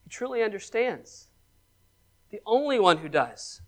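very soft, after a long pause; hushed. Real, not acted. Pastor hushes to comfort his listeners. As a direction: let it sink in, nearly hushed.

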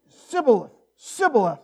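SIBBOLETH with a plain S for SH, loud and falling; mispronounced shibboleth. Shown as a demonstration. Preacher trying the test word himself, SIBBOLETH with S. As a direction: hit SIBBOLETH hard with a hissed S opening. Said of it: "I can't pronounce it right either".

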